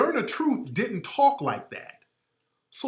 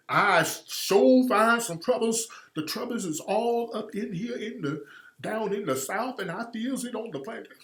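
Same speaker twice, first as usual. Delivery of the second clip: soft, drawling Southern caricature, trailing down; Jesse Lee Peterson; 5 dB quieter than usual. Acted. Mocking how they got her sounding, in soft plantation babble. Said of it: "sound like Jesse Lee Peterson".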